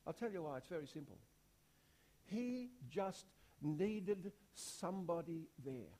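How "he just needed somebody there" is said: slow and weighty, each word spaced; slow, deliberate. Real, not acted. Preacher giving the sermon's point, slow and deliberate. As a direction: lay the key line down gently. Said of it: "slow key line of sermon".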